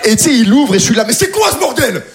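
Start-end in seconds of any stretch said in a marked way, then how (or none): 1.1-2.0 high, heated tirade; angry rant. Retold or imitated. Comedian yelling at his neighbor at dawn, high and heated. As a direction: tell off the neighbor in a high rage.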